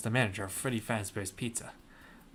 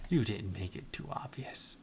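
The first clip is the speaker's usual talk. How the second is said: whispered teasingly, much softer; whispered aside to the listeners; mock whisper; 7 dB quieter than usual. Shown as a demonstration. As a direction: hush it like a secret.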